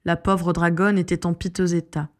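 usual speaking voice

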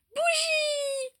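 put-on very high, drawn-out little girl squeal; piping child's exclamation; toddler's cry. A narrator's reading. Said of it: "s'écria-t-elle".

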